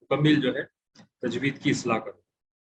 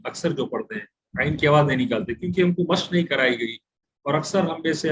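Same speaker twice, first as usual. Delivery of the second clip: normal talking voice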